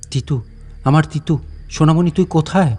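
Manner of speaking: mild imitation of a grieving husband calling out; retold grieving cry. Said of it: "কান্নায় ভেঙে পড়েছিল".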